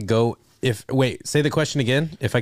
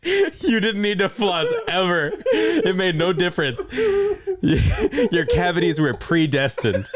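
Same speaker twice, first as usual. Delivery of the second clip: laughing; 7 semitones higher than usual. Real, not acted. Mock revelation while laughing.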